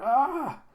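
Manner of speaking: high, loud cartoon scream; mock pained scream. Thug attacked from behind, high mock scream. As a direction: shriek it in mock agony.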